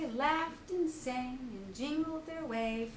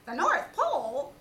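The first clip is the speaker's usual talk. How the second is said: loud, startled Rabbit; exclaimed Rabbit; 3 semitones higher, 7 dB louder than usual. Acted. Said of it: "exclaimed Rabbit".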